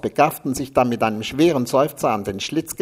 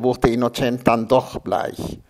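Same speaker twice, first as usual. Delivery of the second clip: ordinary delivery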